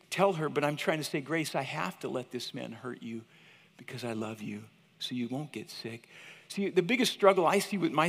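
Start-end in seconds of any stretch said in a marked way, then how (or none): 1.2-6.1 low, soft, pained reassurance; hushed, loving dad voice; tender father. Retold or imitated. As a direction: gently explain it to a crying child. Father comforting crying baby in story.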